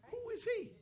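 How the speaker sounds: high-pitched incredulous demand; indignant challenge. Acted. David challenges Goliath in story.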